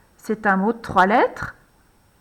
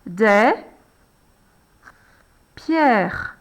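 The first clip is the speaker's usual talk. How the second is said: slow dictation; 2 semitones lower than usual. Shown as a demonstration. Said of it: "on recommence une deuxième fois".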